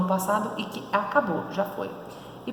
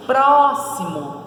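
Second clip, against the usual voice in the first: Próximo with a long, high, loud PRÓ; stressed syllable stretched; 5 semitones higher, 5 dB louder, much slower than usual. Shown as a demonstration. Teacher showing the tonic syllable, Próximo with PRÓ stretched. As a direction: punch and hold the PRÓ in Próximo. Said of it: "tá na antepenúltima a sílaba tônica".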